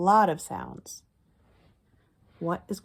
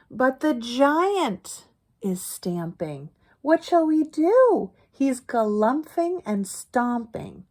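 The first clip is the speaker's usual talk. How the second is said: higher, louder sing-song read-aloud; higher, slower storytime chant; reading to children; 5 semitones higher, 7 dB louder, slower than usual. Acted. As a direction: chant the refrain for little listeners. Reading rhyming picture book aloud.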